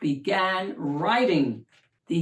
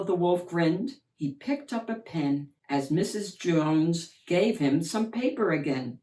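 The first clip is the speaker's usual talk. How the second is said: plain reading voice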